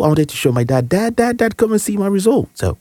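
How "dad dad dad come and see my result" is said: high, eager calling out for Dad; excited calling. Retold or imitated. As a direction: call him in, bursting to show off the result. Boy calling dad with exam result.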